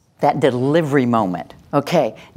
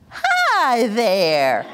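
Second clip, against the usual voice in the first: charmed reply; 6 semitones higher than usual. Retold or imitated. Adult answering the toddler.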